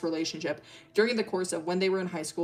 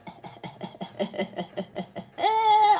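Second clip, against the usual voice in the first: evil giggle; 13 semitones higher than usual. Real, not acted. Laughing at own tease.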